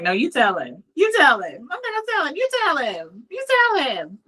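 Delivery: high-pitched imitation of a reluctant kid; mock reluctant refusal. Son refusing to share his news.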